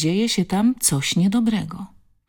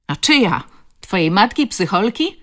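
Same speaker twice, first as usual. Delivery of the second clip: jeering bully; 5 semitones higher, 6 dB louder than usual. A narrator's reading. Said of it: "zawtórował kolejny wyrostek".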